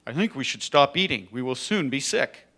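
lively storytime narration; animated children's story reading. A narrator's reading. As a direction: read it to children with big swoops. Reads a children's story aloud.

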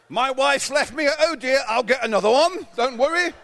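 mock posh "old boy" bravado; stiff upper lip Englishman. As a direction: play the unflappable English gent, brushing off disaster cheerily. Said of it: "And being English, that's just double the case".

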